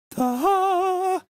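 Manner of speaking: high, drawn-out ta-da; mock big reveal. Streamer teasing new things coming to chat, high mock fanfare. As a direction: sing it out like a fanfare.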